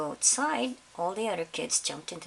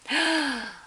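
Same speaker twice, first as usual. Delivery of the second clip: surprised gasp; 8 semitones higher, 4 dB louder than usual. Real, not acted. Reader reacting to picture.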